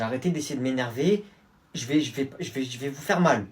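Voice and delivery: ordinary talk, nothing marked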